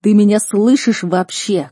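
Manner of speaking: loud, exasperated parental demand; emphatic, stretched angry question; angry shout. Acted. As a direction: raise the voice impatiently. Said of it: "крикнул родитель".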